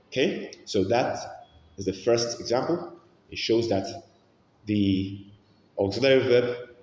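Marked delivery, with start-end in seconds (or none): none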